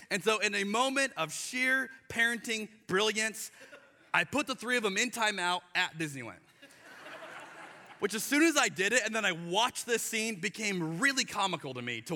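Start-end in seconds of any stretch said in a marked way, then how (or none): none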